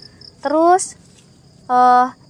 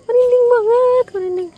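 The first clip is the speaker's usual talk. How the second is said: high nervous laughing outburst, a bit louder; 11 semitones higher, 5 dB louder than usual. Real, not acted. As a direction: laugh off the goosebumps. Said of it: "saya merinding".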